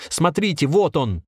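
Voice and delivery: high, urgent call; shouting. A narrator's reading. Trapped man shows captors the stone, shouting. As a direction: cry out for them to look. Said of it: "закричал Анатолий в окошко".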